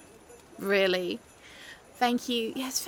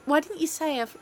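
ordinary talk, nothing marked

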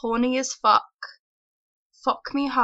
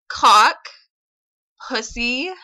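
put-on Valley girl voice, louder, with lilting rises; 4 dB louder, slower than usual. Shown as a demonstration. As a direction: ham up a ditzy Valley girl upspeak. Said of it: "I can do a really, apparently attractive Valley girl".

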